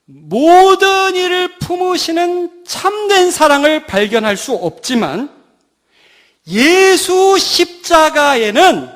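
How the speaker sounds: high, loud, drawn-out proclamation; ringing pulpit declaration; full-force preaching. Real, not acted. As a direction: proclaim it slowly to the whole congregation.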